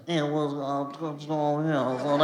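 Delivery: halting, half-swallowed New York mutter; Venom. Acted. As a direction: mumble through a bad New York accent. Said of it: "New York accent where it's like".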